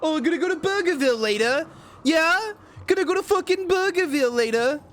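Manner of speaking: high, hammed-up fake British voice, mocking; mock Americans in a bad British accent. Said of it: "a person from the U K would say to make fun of Americans".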